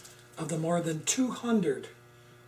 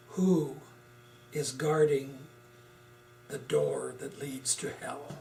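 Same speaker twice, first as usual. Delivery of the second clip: ominous spooky voice; 3 semitones lower, 4 dB quieter than usual. Acted. Ominous horror movie tagline.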